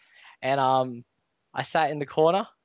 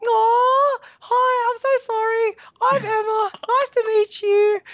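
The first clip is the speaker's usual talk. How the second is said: put-on very high, loud sugary girly squeal, slower; cutesy girl voice; 5 dB louder, much slower than usual. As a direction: play her as a sweet, bubbly girl apologising in a squeaky falsetto. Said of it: "And then she's like".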